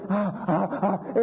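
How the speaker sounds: breathless happy ah's; mock overwhelmed laughter; delighted laughing gasps. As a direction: stammer out joy with little laughs. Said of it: "He's going".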